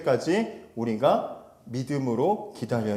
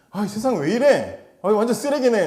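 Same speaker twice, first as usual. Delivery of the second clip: higher, louder disgusted grumble; grumbling gripe; 7 semitones higher, 5 dB louder than usual. Retold or imitated. Habakkuk griping at the world, higher and louder disgusted grumble. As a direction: grouse at the world like a sour news junkie. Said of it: "막 이러면서 막 그냥 투덜거리는".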